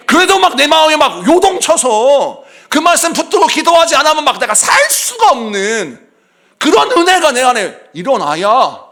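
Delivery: loud, high, driving sermon climax; fervent preaching. Acted. Pastor stirring the congregation, fervent loud preaching. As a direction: preach it with rising fire.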